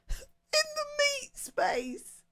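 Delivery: very high, drawn-out joke squeal; silly high voice. Pretend. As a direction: squeak it out slowly through the giggles. Silly joke amid laughter.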